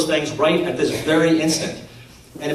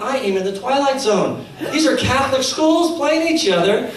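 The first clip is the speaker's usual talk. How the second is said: incredulous thought; 7 semitones higher than usual. Retold or imitated. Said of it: "And I'm thinking".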